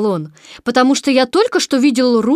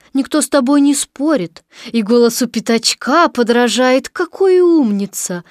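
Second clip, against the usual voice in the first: usual speaking voice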